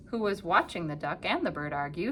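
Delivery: ordinary delivery, nothing marked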